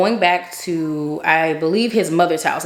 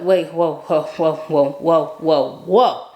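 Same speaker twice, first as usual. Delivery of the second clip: anxious whoas; much slower than usual. Retold or imitated. Pressure and anxiety rising.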